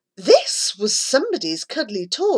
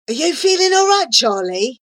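confused; 5 semitones higher, 3 dB louder, faster than usual. A narrator's reading. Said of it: "looking very confused".

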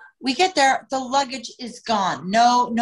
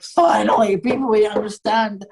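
relieved cheer; 5 dB louder than usual. Retold or imitated. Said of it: "they were like".

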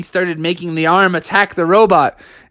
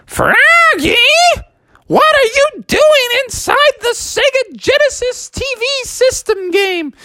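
calling mom. Acted. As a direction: call your kid home. Mom calls from outside.

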